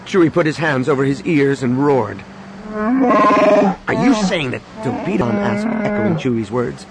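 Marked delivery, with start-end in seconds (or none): 2.7-5.3 alarmed disbelief. Acted. Han alarmed.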